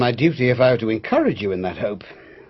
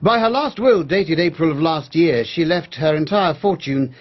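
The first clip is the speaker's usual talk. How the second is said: slow, measured and a bit louder, like reading out a legal document; formal will reading; 4 semitones higher, 5 dB louder, slower than usual. Acted. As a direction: state the terms of the will deliberately and clearly. Lawyer formally reads the will.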